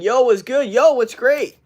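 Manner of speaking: hammed-up high, loud greeting; mock greeting. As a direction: mimic a pumped-up hello. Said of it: "Imagine if someone's like".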